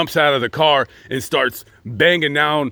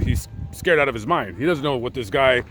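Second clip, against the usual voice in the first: normal talking voice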